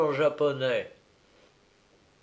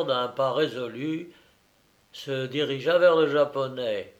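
ordinary delivery, nothing marked

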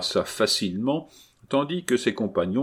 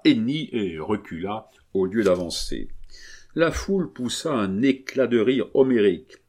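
ordinary delivery, nothing marked

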